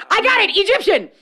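high, loud eager shout; excited guess. Retold or imitated. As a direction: blurt it out like a proud winner. Mocking Victorians guessing ethnicity.

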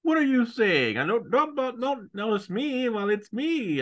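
high, put-on villain whine; squeaky mock villain protest. Co-host imitating a villain caught eavesdropping, in a high squeak. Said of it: "He's like".

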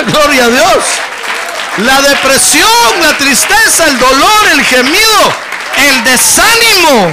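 high, loud, ringing scripture proclamation; fiery preaching. Acted. Pastor reads the promise to the congregation in full preaching voice. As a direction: lift the verse up over the crowd.

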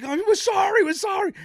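high, loud, panicked pleading; frantic apology. Retold or imitated. Buddy begging the angry fan, high panicked shout. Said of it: "he's like".

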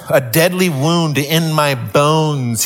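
"a deadly wound in my bones" drawn out slowly; slow, weighty repeat. Shown as a demonstration. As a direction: let "deadly wound" sink in. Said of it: "repeated slowly for weight".

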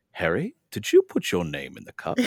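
measured, gentle questioning; real calm. Retold or imitated. Imitating book Dumbledore questioning Harry, real calm. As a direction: ask it calmly, with an acted accent. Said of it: "real calmly".

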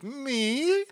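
very high-pitched squeak of disbelief; surprised. Retold or imitated. SpongeBob thinking the cheers are for him, high surprised squeak. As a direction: squeal it as a delighted question. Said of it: "And he's like".

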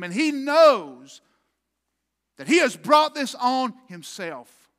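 loud, high, rising sermon climax; preaching at full force. Acted. Preacher pounding out David's anguish to the congregation, loud and high. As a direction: build the hall up to a fever pitch.

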